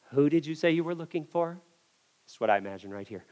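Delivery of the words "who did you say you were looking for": soft, calm question; hushed, unruffled asking; Jesus. Acted. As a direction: ask it quietly, fully in control. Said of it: "Jesus stands there calmly and says".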